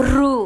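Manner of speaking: রু heavy, trilled and held; thick R. Shown as a demonstration. Teacher marks the second thick R, heavy রু. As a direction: roll into a full রু. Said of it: "মোটা".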